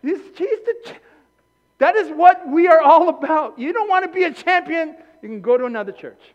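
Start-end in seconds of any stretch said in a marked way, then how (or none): 0.0-5.0 loud, high cheering; excited hype. Real, not acted. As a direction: pump up the crowd. Hyping a congregant up.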